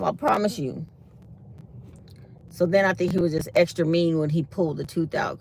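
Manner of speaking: ordinary talk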